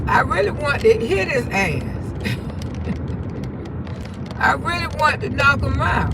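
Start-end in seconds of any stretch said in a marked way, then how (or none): 1.9-4.3 breathy, voiceless laugh; wheezy chuckle at her own confession; quiet, drawn-out laugh. Real, not acted. Laughs about wanting to hit him.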